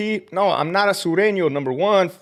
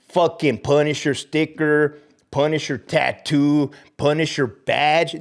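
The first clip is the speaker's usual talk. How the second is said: ordinary talk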